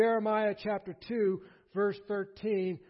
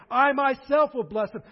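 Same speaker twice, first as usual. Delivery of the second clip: I myself, loud and raised in pitch; stress on I; 5 semitones higher, 9 dB louder than usual. Shown as a demonstration. God saying who does the blessing, emphatic stress on I. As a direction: lean hard on I myself. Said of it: "The emphasis is on".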